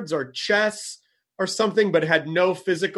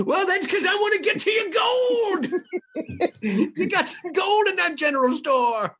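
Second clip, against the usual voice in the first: put-on much higher, laughing greedy old miner; old prospector. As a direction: cackle it out like a gold-hungry prospector.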